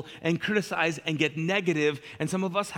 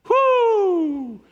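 high, loud sarcastic huff; mock exasperated whoo; 8 dB louder than usual. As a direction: blow off steam theatrically. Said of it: "passive aggressive".

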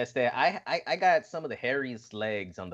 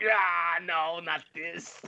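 mock disgusted protest; 7 semitones higher, much slower than usual. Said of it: "He's all like".

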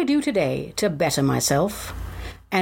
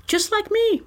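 playful; 13 semitones higher than usual. Acted. Playful self-joke.

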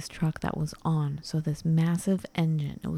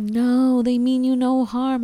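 raised neighbor's shout, higher and louder; yelling neighbor; 6 semitones higher, 4 dB louder, slower than usual. Retold or imitated. Neighbor Steve yelling over the truck noise, raised voice. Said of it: "and he's yelling".

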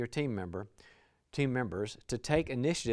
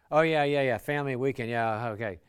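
louder, hurried yeah-yeah of an impatient friend; dismissive brush-off; 8 dB louder than usual. Retold or imitated. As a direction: rush past the small talk, eager to get to business. Task-focused friend brushing off chat.

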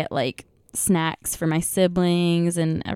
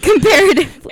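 loud, high laughing correction; laughing emphasis; 9 semitones higher, 10 dB louder than usual. Real, not acted. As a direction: burst out with it laughing.

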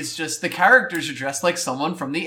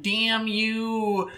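awkward nerd joke; 5 semitones higher, 7 dB quieter than usual. Retold or imitated. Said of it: "really wants to sell a joke and they do that".

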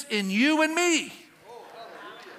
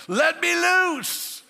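higher, very slow, drawn-out cry to be loosed; God pleading; 8 semitones higher, 4 dB louder, much slower than usual. Acted. God inside the believer begging to be set loose, high and slow. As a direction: draw it out long and high like straining at a leash. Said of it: "And God says".

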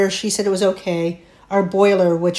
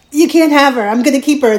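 higher, louder retold cry of refusal; panicked protest; 8 semitones higher, 9 dB louder, faster than usual. Daughter refusing the paramedics, a higher, louder panicked protest. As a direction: hold on to her, refusing to let go. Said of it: "I'm like".

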